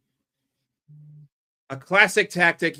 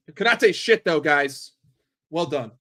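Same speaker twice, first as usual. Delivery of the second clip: ordinary talk, nothing marked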